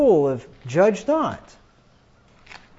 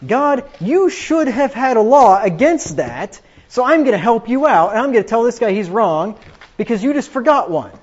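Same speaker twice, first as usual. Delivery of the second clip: loud, higher mock address to God; mock self-righteous complaint, pitched up and emphatic; presumptuous believer; 4 semitones higher, 5 dB louder than usual. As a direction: lecture God as if correcting an oversight. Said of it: "you know what you're saying".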